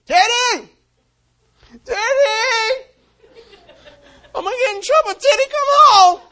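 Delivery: high, loud, half-laughing desperate yell; calling out desperately. Retold or imitated. Owner searching streets at night, frantic loud calling. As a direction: shout for the lost dog, voice cracking.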